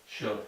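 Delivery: should dicho sin la L, como /ʃud/; should without L. Shown as a demonstration. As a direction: say should with no L sound. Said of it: "No pronunciar la L". (should sin pronunciar la L)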